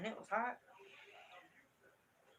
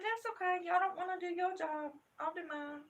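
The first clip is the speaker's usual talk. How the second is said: high, louder mock-agreeable retort; retold defiance; 8 semitones higher, 5 dB louder than usual. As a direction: tell off the staff, voice pitched up. Retold confrontation with school staff.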